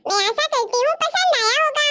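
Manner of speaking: high-pitched presenter voice; video outro. Real, not acted. Host asking viewers to like and share the video, presenter outro.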